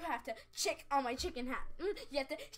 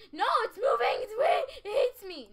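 high, loud whining cry; crying shout; 9 semitones higher, 11 dB louder than usual. Real, not acted. Player shouting at a fleeing ladybug in a high, loud cry. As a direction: wail at the ladybug running off. Ladybug keeps escaping her.

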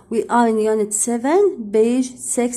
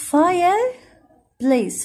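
Fireplace stretched very slowly, pitched higher; teacher stretching Fireplace slowly so pupils hear each part; slow drawn-out model; 6 semitones higher, much slower than usual. Shown as a demonstration. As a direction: draw out Fireplace for the class to copy. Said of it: "teacher models, then repeats".

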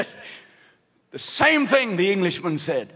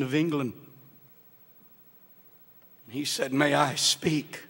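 softer and lower, drawing the church into the tale; hushed story narration; 9 semitones lower, 5 dB quieter than usual. A narrator's reading. Pastor telling the Titanic survivor's story, hushed and lower.

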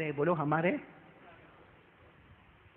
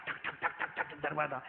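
soft, breathy knock-knock imitation; knocking sounds; 5 semitones lower, 7 dB quieter than usual. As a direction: tap out the knocking under the breath. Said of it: "दरवाजा खिटखिटा रहा है". (दरवाज़े की ठक ठक की धीमी नकल)

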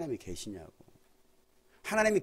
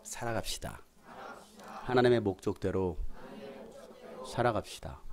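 call and response. Real, not acted. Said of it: "따라서 합시다".

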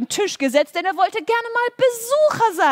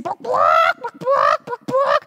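chicken clucking; 8 semitones higher, 4 dB louder than usual. Acted.

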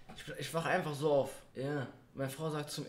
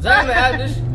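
laughing; 5 semitones higher, 15 dB louder than usual. Real, not acted. Laughing after prank.

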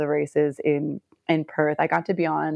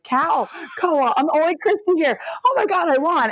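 high-pitched, incredulous realisation; re-enacted stunned delight, pitched high; retold astonishment; 11 semitones higher than usual. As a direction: relive the disbelief of winning. Acts out her shocked realisation of winning.

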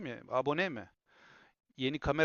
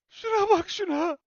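excited squeal; 7 dB louder than usual. Real, not acted. Thrilled by camera focus.

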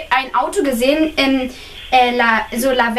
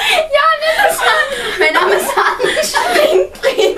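high, loud, laughing words; laughing hard; 11 semitones higher, 6 dB louder than usual. Real, not acted. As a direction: giggle through the words. Laughing at joke name.

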